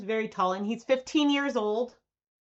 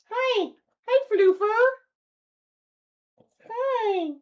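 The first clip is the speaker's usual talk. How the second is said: higher cooing hellos to the dog; pet talk; 13 semitones higher, 6 dB louder, slower than usual. Real, not acted. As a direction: croon a greeting to the dog. Greeting the dog.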